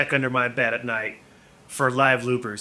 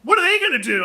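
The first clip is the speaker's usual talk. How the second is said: self-mockery; 14 semitones higher, 4 dB louder than usual. Mocking himself.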